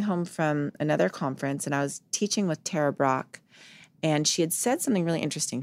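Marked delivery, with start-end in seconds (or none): none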